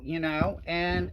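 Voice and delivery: ordinary talk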